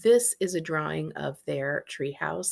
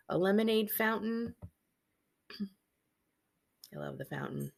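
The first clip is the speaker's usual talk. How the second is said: ordinary delivery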